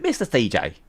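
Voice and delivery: lightly imitated catchphrase; impression of Mister C J. Streamer repeats his impression, lightly put on. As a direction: toss out the name as a catchphrase. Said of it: "That's a good impression".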